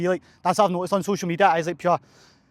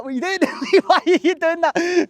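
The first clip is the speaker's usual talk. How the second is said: pitched-up laughing what are you doing; incredulous laughing squeal; 11 semitones higher, 6 dB louder than usual. Retold or imitated. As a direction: squeal it at a paused Strava run, laughing. You're like.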